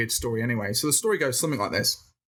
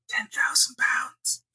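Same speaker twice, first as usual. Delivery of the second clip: whisper; 11 semitones higher, much slower than usual. Retold or imitated. Mourning the lost prize.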